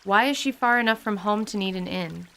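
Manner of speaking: usual speaking voice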